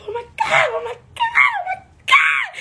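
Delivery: high, shrieking oh my God in disbelief; excited squeals. Retold or imitated. Starstruck fan reliving meeting the host, high shrieks. Screaming on seeing Steve Harvey.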